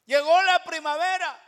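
soaring high, full-voiced cry; preacher's triumphant shout; shouted proclamation. Real, not acted. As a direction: proclaim it to the whole church. Preacher proclaims spring arriving.

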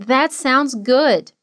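slow, careful phrasing; slow model. Shown as a demonstration. Teacher modeling a phrase for students to repeat. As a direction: give each word time to land.

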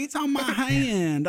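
high, slow Southern drawl; stretched-out mock Southern twang; kid's Southern accent. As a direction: imitate a little kid with a thick Southern drawl. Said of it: "she's like".

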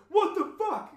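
very high, loud outburst; crowd's shocked yell. Retold or imitated. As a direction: imitate startled buddies yelling. Voices everyone's shock at feathers.

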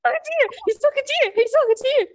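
high, giddy exclamation; giddy excitement. Real, not acted. Friend joins in the excitement.

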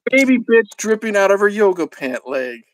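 ordinary talk

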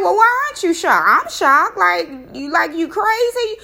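high, loud, disbelieving; retold shocked outburst, pitched high. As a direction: throw the questions at him in alarm. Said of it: "I'm like".

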